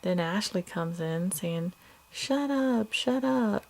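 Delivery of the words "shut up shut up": put-on higher, little girl parroting a yell; a toddler copying daddy, pitched up; imitating a small child. Toddler parroting her father.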